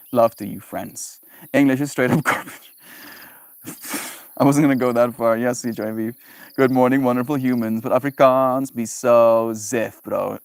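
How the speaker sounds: usual speaking voice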